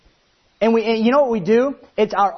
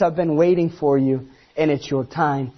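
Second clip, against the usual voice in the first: a hint of a tender, lower Jesus voice; compassionate Jesus; 6 semitones lower than usual. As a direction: gently welcome them home. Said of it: "He compassionately says".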